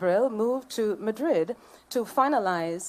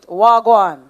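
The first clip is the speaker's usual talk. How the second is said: Jamaican patois "Wagwan" belted out much louder; Jamaican patois greeting; 15 dB louder than usual. Shown as a demonstration. Speaker greeting the hotel guests in Jamaican patois, shouted. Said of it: "So let me say it".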